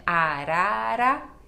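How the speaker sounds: Arara said very slowly, each R tapped; tapped R between vowels. Shown as a demonstration. Teacher repeating Arara slowly for the class. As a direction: drag out Arara, flicking each R. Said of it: "tem o som de ro".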